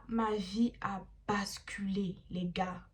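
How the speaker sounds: slow, hushed ominous reveal; storyteller's ominous hush; dramatic whisper. Acted. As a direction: drop to a whisper for the twist.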